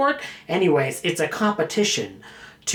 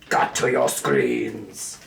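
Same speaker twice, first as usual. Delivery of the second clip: dramatic low voice; much slower than usual. Pretend. Dramatic deep drop.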